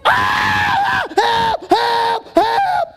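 very high, loud repeated screams; high-pitched yells of terror, hammed up; screaming for help. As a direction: scream over and over in comic panic. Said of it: "I'm still screaming".

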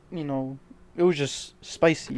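normal talking voice

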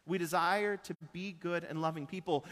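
ordinary talk

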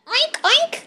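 very high giggly oinks; tiny squealing pig laugh; amused piglet. A narrator's reading. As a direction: snort with piglet glee. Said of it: "le parece muy divertido".

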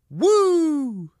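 high, loud wrestling-promo woo; loud strutting woo; Ric Flair woo. Retold or imitated. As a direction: let out a big strutting woo like the Nature Boy. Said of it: "the woo always makes me cough".

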